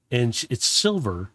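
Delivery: ordinary talk, nothing marked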